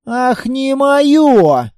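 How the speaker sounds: high, long-drawn outraged echo; angry. A narrator's reading. Looter seriously angry at the sergeant, outraged. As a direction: throw it back slowly, rising in anger. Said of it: "Я уже тоже был зол не на шутку".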